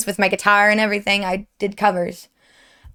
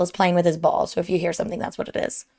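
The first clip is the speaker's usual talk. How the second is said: hushed aside; 4 semitones lower, 6 dB quieter, faster than usual. Real, not acted. Quiet aside about the dog.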